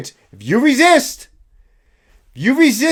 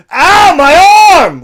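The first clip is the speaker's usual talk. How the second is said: loud, high, slow mocking cry of pain; mock a whining scream of pain; 9 semitones higher, 13 dB louder, much slower than usual. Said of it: "except scream".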